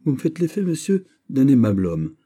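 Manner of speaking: high-pitched, delighted exclamation; lively, lifted voice of sudden approval; exclaiming. A narrator's reading. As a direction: pay the compliment with animated emphasis. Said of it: "s'écria Guiche".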